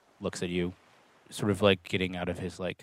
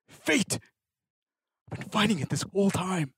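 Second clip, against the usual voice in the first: bitter outburst; 8 semitones higher than usual. Acted. Salt raging at fate.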